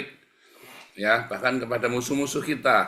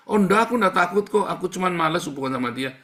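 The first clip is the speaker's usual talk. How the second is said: higher, louder, fast protesting voice; defensive excuse; 7 semitones higher, 4 dB louder, faster than usual. Acted. Pastor mimicking a believer who denies fearing his enemy, defensive protest. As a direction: play the denying listener.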